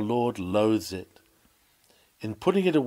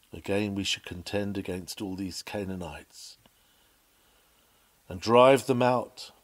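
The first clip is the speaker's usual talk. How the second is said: ordinary talk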